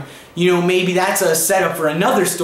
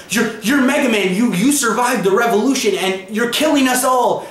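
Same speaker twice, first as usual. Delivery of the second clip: retold panic, higher voice, stammering; freaking out; 5 semitones higher than usual. Time traveler recognizing Mega Man, higher panicky stammer. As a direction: stammer it out like a man seeing a ghost. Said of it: "he freaks out".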